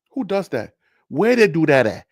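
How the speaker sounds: usual speaking voice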